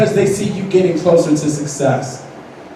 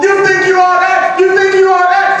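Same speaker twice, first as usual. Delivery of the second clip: high, shouted mock tirade; hammed-up jeering, climbing to a shriek at the end; hater's rant; 7 semitones higher than usual. Hater rants at student council member, high mock shouting.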